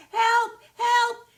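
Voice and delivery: very high, loud cry for help; shout for help. Acted. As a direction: scream it down the hall. Jimmy calls for help.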